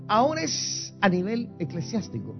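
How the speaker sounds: normal talking voice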